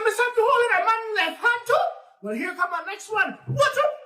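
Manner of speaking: high, loud taunting voice; cocky challenge. Acted. Nancy challenging the tar baby, high cocky taunt. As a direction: dare him to take another hit.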